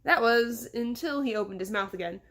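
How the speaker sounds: ordinary delivery, nothing marked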